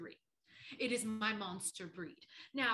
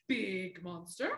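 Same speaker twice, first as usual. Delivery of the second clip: big monster; 6 semitones lower, slower than usual. Shown as a demonstration. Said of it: "One more time".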